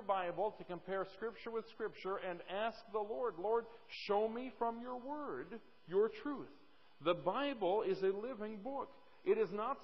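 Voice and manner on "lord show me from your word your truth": higher, slow, pleading prayer; prayerful plea. Acted. Preacher voices a believer's prayer.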